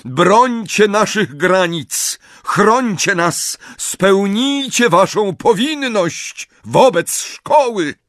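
rally the statues; commanding call. Acted.

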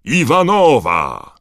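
loud, high, drawn-out name call; stadium announcer. A narrator's reading. Bagman introducing a Bulgarian player, booming call. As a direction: belt the name out, stretching it.

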